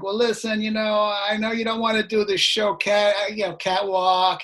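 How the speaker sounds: lightly imitating a New York music boss; hint of a New York accent, coaxing. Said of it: "He's got this New York accent".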